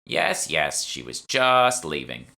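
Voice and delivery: a hint of a shopkeeper shooing someone; dismissive old shopwoman. Shopwoman hustling the narrator off, slow and dismissive.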